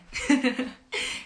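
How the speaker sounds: airy hooting monkey call; monkey-like laughing hoot. Shown as a demonstration. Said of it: "I'll make the sound again".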